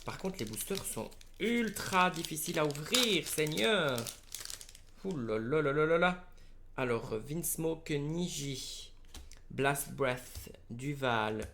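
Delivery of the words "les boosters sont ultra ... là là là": exasperated. Real, not acted. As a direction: moan in exasperation at a stubborn wrapper. Struggling to open the boosters. (exaspération plaintive, voix plus aiguë)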